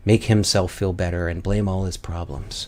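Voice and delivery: usual speaking voice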